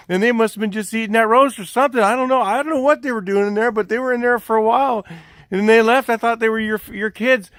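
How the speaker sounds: high, loud mock alibi; hammed-up innocent bystander; feigned innocence. Man keeps up false alibi.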